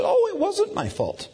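very high-pitched playful denial; mock protest. Dad denying blame to his son, high mock protest.

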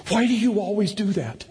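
high put-on little boy voice; imitated protesting child. Son complaining about dad's trick, high mock child voice. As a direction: protest like an exasperated kid. Voicing the laughing son's protest.

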